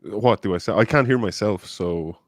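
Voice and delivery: usual speaking voice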